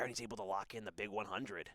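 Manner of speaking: ordinary talk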